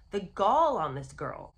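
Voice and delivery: ordinary talk, nothing marked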